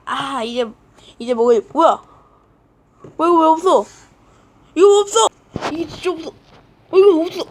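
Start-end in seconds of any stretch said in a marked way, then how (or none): 3.1-7.4 rising, louder cry of where is my bread; panicked and indignant, pushing up in pitch; much higher, louder frantic complaint; upset outburst. Acted. Discovers the bread is missing.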